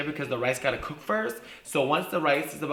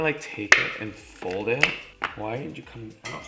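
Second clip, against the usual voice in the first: usual speaking voice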